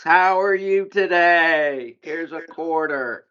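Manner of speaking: put-on high, very slow, doting old aunt voice. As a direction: coo like an aunt pinching cheeks. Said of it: "the old aunt from, like, the seventies".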